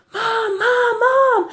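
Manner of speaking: high-pitched imitation of a yelling boy; mock child's yell. Five-year-old running across the field to his mother, mock yelling. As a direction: call out high for Mom, imitating a kid. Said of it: "he's yelling".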